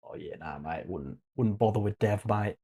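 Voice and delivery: put-on a light Australian accent, offhand; lazy Aussie mate brushing it off. Shrugging off dev to viewers in an Australian accent. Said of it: "I'm putting on an Australian accent".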